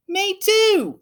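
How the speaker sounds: put-on high squeaky chorus of kids; chiming kids. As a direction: pipe up all together. Friends chime in.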